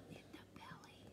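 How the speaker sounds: hushed quick whisper. Acted. Storyteller whispering where Max went. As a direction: breathe it like a secret.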